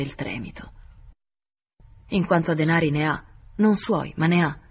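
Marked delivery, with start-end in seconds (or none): none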